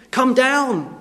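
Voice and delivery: jeering "Come down" called up high; mocking taunt. Crowd mocking Jesus on the cross, jeering taunt. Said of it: "the mocking and the jibes that people gave".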